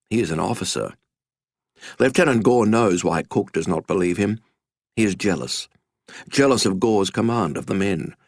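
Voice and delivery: ordinary delivery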